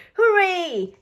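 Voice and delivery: high, loud hooray; cheer. A narrator's reading. Rabbits cheering at all the noisy music. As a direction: cheer like happy little kids. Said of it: "cheers Rebecca and Richard".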